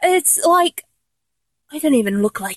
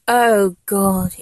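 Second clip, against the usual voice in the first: usual speaking voice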